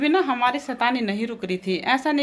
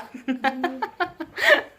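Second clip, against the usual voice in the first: high, bursting giggle; can't hold back a laugh; real laugh; 8 semitones higher than usual. Real, not acted. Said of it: "मेरे को तो बहुत हंसी आ रही है".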